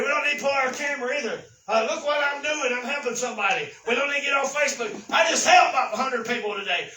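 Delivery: fiery revival preaching; full-force preaching. Acted. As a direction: drive the point home to the church.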